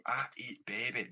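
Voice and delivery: slow comic Scottish catchphrase; Scottish accent. Shown as a demonstration. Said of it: "that's my take on".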